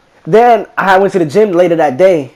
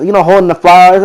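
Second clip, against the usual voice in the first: ordinary talk, nothing marked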